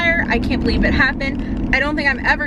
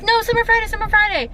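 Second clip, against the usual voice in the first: put-on much higher bossy kid voice; mimicking a little girl; 11 semitones higher, faster than usual. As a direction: squeak it like a bossy little girl correcting her friend. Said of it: "Another girl's like".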